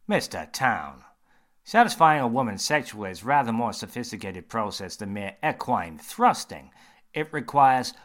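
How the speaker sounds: a confident doctor holding forth; surgeon voice. Acted. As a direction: surgeon quizzing James, bright and loud.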